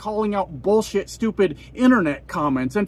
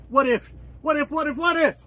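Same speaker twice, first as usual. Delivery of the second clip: mocking what if; 6 semitones higher than usual. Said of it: "mocking the phrase repeatedly".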